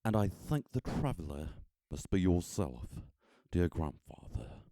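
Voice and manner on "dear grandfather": ordinary delivery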